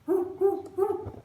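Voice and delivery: bouncy, high monkey hooting; monkey hoots. A narrator's reading. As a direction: hoot like a storybook monkey. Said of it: "says the monkey".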